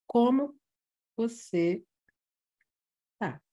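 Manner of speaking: "Como", pause, "você", pause, "tá?" split apart; broken by pauses. Shown as a demonstration. Teacher showing a badly placed comma in a question. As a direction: chop it into three slow words.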